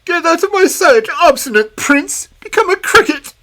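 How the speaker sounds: enraged fairy casting a spell; furious cry. Acted. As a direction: shriek a curse at the prince. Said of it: "the old fairy in fury cried".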